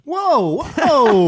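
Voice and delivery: mock alarm. Reacting to being blamed.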